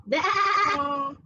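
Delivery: quavering baa to copy; sheep bleat. Shown as a demonstration. Parent asking kids to copy it, sheep bleat. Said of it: "Can you do it?".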